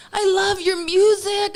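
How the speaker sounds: high, drawn-out fan gush; gushing wasted raver. Retold or imitated. Ravers on ecstasy greeting the singer, high dreamy gush. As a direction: imitate a blissed-out raver, slow and high.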